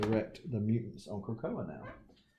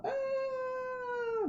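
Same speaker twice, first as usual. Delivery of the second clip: a sudden, very high mock whine; whiny Colossus. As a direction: squeal it like a whiny kid. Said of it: "Colossus whining like a little girl".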